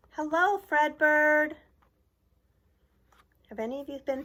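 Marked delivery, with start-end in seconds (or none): 0.1-1.5 slow, high, loud cheerful greeting; cheering fans. Acted. Fans greeting Fredbird at the stadium, loud happy cheer. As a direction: call out to the mascot like a happy crowd. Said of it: "Cardinals fans cheered".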